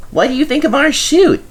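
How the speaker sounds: high, bright little engine; high-pitched little engine greeting; small engine voice. A narrator's reading. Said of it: "said a voice".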